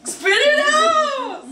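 laughing shriek, high and slow; shouting. Real, not acted. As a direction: yell it out through laughter. Disgusting jelly bean challenge reaction.

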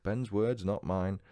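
ordinary talk, nothing marked

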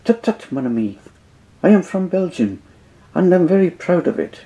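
high, fussy Belgian detective; light Belgian-French accent; Poirot's accent. Acted. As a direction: correct them with pride. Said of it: "the great French detective".

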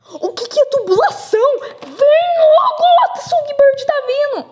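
high, frantic alarm; pânico. Acted. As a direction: urge them to hurry in a high panic. Impostores quase pegos, entram em pânico. (em pânico, voz aguda e apressando)

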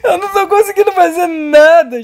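high loud whiny wail; half-crying complaint; frustrated crying. Real, not acted. Frustration, cry heard.

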